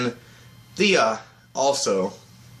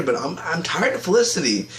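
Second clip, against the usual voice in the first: ordinary delivery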